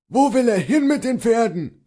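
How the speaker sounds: high-pitched, accusing question; sharp demand. A narrator's reading. Bailiff grabs the servant by the chest, high angry demand. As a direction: demand an answer from a suspect.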